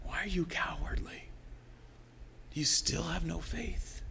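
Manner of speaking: soft, near-whispered self-rebuke; subdued self-questioning. Retold or imitated. Preacher taking Jesus's rebuke to himself, subdued. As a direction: murmur the question inwardly.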